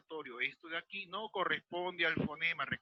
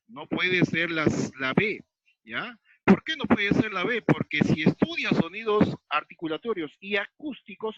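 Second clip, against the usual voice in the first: emphatic correction; 2 semitones higher, 11 dB louder than usual. Real, not acted. Teacher corrects the class's wrong answer, loud and insistent. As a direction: hammer the point home to the class.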